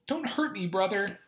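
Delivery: put-on high, frightened little boy; Doodle's voice. Collapsed little brother begging not to be hurt, high and scared. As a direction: plead small and scared.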